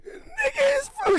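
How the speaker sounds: high, slow cracking-up cry; laughing squeal. Real, not acted.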